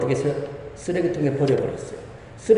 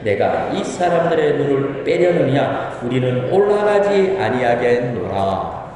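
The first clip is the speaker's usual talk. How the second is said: scripture reading; 3 semitones lower than usual. Acted. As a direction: read the passage out solemnly for all to follow. Reads scripture aloud with congregation.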